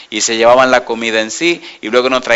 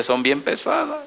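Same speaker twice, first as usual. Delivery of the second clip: softer and higher, a secret warning; hushed warning; 11 semitones higher, 10 dB quieter than usual. Retold or imitated. Pastor quietly warning that the other courses are heavy, hushed. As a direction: let it slip under your breath.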